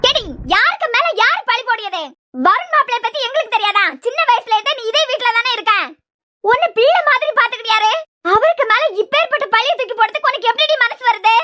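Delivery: high, indignant tirade; outraged scolding. Acted. Mother defending Varun against the accusation, outraged scolding. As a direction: rebuke her with pressing outrage.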